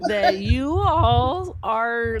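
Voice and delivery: exasperated laugh. Real, not acted. Host exasperated with caller.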